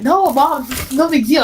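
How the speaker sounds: high-pitched whiny protest; whiny kid pleading. Retold or imitated.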